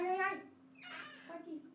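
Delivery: ordinary talk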